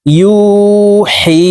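ইউহি with হি stretched one alif, slow; long recited demo. Shown as a demonstration. Teacher showing viewers how to stretch ইউহি. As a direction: draw ইউহি out, pulling হি long. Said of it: "দেখুন, এইভাবে পড়ব শব্দটি".

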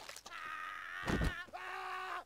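shrieking laugh. Real, not acted. Gamer bursts into a high shrieking laugh. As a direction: cackle wildly at the screen.

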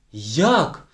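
loud, sharp one-word outburst; indignant. A narrator's reading. Teacher reacting to the girl, indignant cry. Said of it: "обурилася вчителька".

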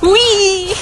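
high put-on whee; squealing pretend joy in the snow; fake glee. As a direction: play at having fun while running. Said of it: "pretend this is really fun".

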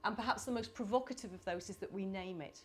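ordinary delivery

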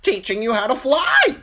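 high, loud bird squawk; urgent crow. A narrator's reading. Crow teaching falling Bran, urgent squawk. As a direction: squawk it over the rushing fall.

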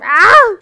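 short loud cry of surprise; sudden loud "aah"; startled yell. Real, not acted. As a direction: yelp as if caught off guard. Startled in the game.

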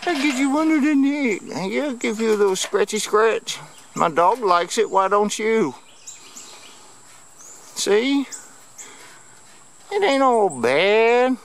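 put-on high coaxing baby voice; high cooing to a turtle under the neck; baby talk. Cooing while petting turtle.

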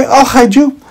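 put-on high, loud little boy; boy calming the scared carrot; reassuring little boy. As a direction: reassure your friend in a child's voice.

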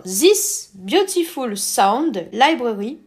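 slow, careful English with a French accent. Real, not acted. Presenter reads the pack description himself, slow French-accented English. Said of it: "désolé pour mon accent anglais".

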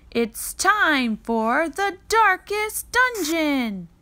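slow, high, loud announcer voice; booming announcer. Acted. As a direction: draw it out like a grand stage announcer. Announcer opens the class play.

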